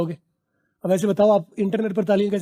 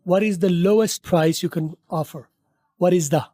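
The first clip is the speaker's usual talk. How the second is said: slow model sentence; much slower than usual. Shown as a demonstration. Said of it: "slow model sentence".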